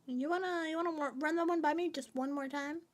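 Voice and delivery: usual speaking voice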